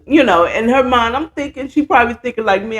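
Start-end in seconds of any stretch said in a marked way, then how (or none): none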